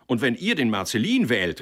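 loud, high retort; shouting faction. A narrator's reading. Rival faction answering the first, shouted. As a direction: throw the warning back loudly. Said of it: "riefen die anderen".

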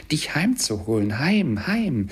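high, swooping, elated repetition; gleeful child. A narrator's reading. Little sister brings her brother good news, gleefully. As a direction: sing out the last words with glee. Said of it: "schüttelte sich vor Lachen".

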